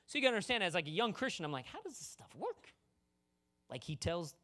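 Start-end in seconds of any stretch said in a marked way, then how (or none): 1.7-2.7 high, soft, puzzled voice; hushed bafflement. Retold or imitated. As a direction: ask it small and high, mystified.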